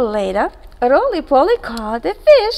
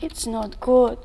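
usual speaking voice

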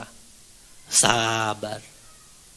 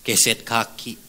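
ordinary talk, nothing marked

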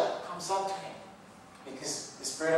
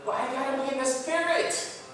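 higher, louder frustrated pleading; venting to himself, pitched up; exasperated inner cry; 8 semitones higher, 5 dB louder than usual. Retold or imitated. Talking to himself about his struggle, higher and louder in exasperation. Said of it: "I'm like".

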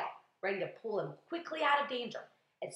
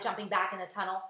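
ordinary delivery, nothing marked

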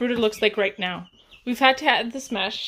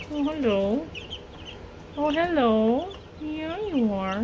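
cooing pet voice; 4 semitones higher, slower than usual. Real, not acted. Cooing to chick.

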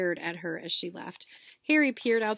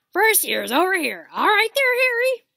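very loud, much higher booming call; 11 semitones higher, 12 dB louder than usual. Acted. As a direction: hail Harry over the crowd. Hagrid calling students.

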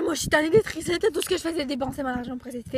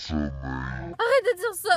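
low slow plea; much slower than usual. Pretend. Pretend plea.

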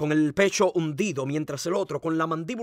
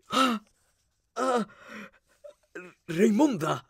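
high gasps, then her name; stricken, high-pitched cry; shocked cry; 7 semitones higher, slower than usual. A narrator's reading. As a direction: gasp in horror and call out to her. Sees Raimunda shot and fallen.

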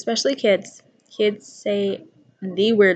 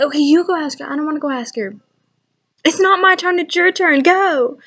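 higher, louder mock kids squabbling; imitating bickering kids; 6 semitones higher, 5 dB louder than usual. Kids arguing over who asks the aunt, mock squabbling. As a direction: play both kids pushing each other to ask. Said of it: "They're like".

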